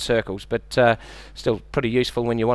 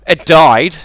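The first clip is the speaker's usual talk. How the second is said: blunt punchline; 8 dB louder than usual. Real, not acted. Punchline after whale question.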